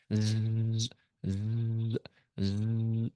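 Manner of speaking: imitated drone buzzing, held and steady. Podcaster mimicking a TV drone hovering around a golfer, droning buzz. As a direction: buzz like a hovering camera drone, in three bursts.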